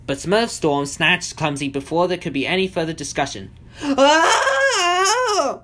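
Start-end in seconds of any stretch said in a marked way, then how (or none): 3.8-5.6 loud, very high shriek; long, high cartoon scream. A narrator's reading. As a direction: shriek as if yanked off the ground. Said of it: "Clumsy shrieked as they lifted off".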